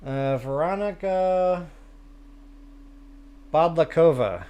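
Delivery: Veronica Bodlakova sounded out slowly, syllable by syllable; slow, careful try at Veronica Bodlakova; careful name attempt. Shown as a demonstration. Streamer carefully trying a viewer's name, Veronica Bodlakova, slowly. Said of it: "I'm gonna have to give that one a try or two".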